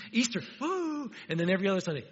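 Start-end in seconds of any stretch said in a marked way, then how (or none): 0.1-1.1 high, playful mock cheer; a light hammed-up woo. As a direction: whoop it like a fan on Easter.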